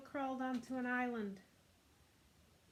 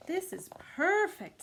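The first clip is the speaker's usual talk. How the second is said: higher, louder delighted cat; pleased angora; 7 semitones higher, 3 dB louder than usual. Acted. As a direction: purr out the pleasure. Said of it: "said Angora".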